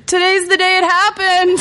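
high-pitched mock yell; retold yell. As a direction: cry it out as if going down. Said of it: "I just yelled".

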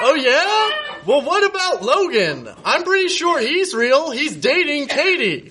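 hammed-up jealous outrage; indignant Nate. Nate voiced in his story.

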